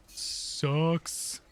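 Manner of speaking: very slow, whiny drawl; drawn-out complaint. Real, not acted. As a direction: drag every word out in complaint. DM complains about the spell.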